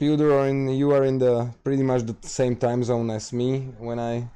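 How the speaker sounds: ordinary talk, nothing marked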